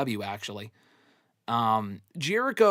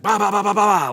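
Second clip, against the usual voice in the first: loud, higher rapid-fire "bah bah bah"; imitated frantic noise; 6 semitones higher, 9 dB louder than usual. Host mimicking a wrestler who goes too hard, loud frantic bahs. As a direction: blurt out a barrage of bahs, imitating nonstop charging.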